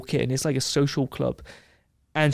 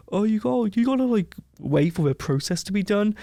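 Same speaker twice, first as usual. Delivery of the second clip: high, mocking bureaucrat voice; mock embassy official; 7 semitones higher than usual. Mocking the embassy staff who won't help, in a high voice. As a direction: pitch it up to mock a useless official.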